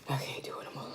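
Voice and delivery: spent whisper; whisper, all out of fight. Real, not acted. Giving up on food.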